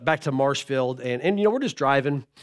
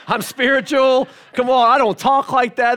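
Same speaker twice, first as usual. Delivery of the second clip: high, loud protest of innocence; hammed-up righteous indignation; mock indignation; 7 semitones higher, 6 dB louder than usual. Father insisting she never heard it from him, mock-indignant.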